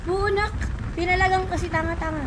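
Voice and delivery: loud annoyed gamer rant; frustrated outburst. Real, not acted. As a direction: snap out in frustration. Frustrated gamer after dying.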